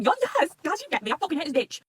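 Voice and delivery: high, loud burst of agreement; emphatic agreement. Acted. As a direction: cheer them on in exasperated relief. Acted fed-up agreement with character.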